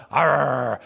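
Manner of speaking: mock dog growl in tug of war; playful growl. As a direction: growl like playing with a puppy. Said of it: "and I'll go".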